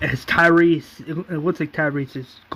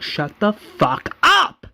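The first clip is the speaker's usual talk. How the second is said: higher, slow, drawn-out mock outburst; angry retort; 6 semitones higher, slower than usual. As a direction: stretch each word into an acted yell. Retold quarrel, Vesta snaps at Merle.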